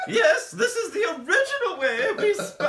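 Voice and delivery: put-on a giggling half-English, half-Julia Child voice; mock fancy accent. Hamming a posh voice between laughs, high and laughing. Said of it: "I can't tell if I'm doing English or Julia Childs".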